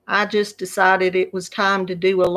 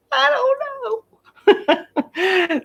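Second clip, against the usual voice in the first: high, laughing I don't know; laughing helplessly; much slower than usual. Retold or imitated. Grandma laughing to her grandson she doesn't know. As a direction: laugh the answer away, pitched way up. Grandson asks how much longer.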